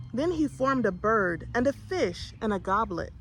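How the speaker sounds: ordinary delivery, nothing marked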